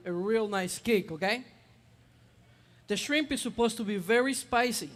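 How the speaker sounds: ordinary talk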